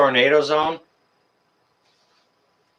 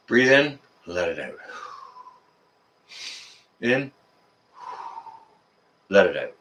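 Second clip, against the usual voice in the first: calming breath; 4 semitones lower, 6 dB quieter than usual. Real, not acted. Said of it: "I was getting a little bit excited there".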